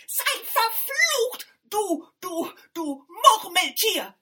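very high, loud, slow curse; angry cursing. A narrator's reading. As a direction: spit the curse out shrieking. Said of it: "schimpfte die Stiefschwester".

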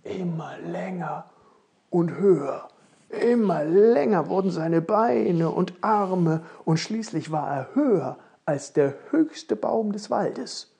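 rising storyteller amazement; building wonder. A narrator's reading. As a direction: stretch it out as he grows. Narrator acts out the growing.

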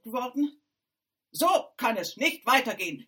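loud, insistent stepmother; harsh command. A narrator's reading. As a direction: hammer the order home.

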